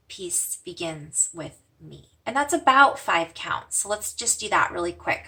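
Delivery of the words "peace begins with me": slow, soft meditation chant; calm mantra, drawn out. Shown as a demonstration. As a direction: say the mantra gently on the breath. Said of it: "Breathing out".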